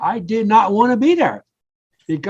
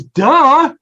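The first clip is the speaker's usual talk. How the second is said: higher, louder teasing duh; grinning duh; 7 semitones higher, 6 dB louder than usual. Retold or imitated. Wife grinning at her husband's obvious epiphany, higher teasing duh. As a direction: tease him with the obvious. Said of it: "she just starts grinning".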